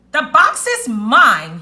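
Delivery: loud, slow, drawn-out claim; storybook character's bold, loud declaration; triumphant shout. A narrator's reading. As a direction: shout it out, stretching each word. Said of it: "he shouted out loud".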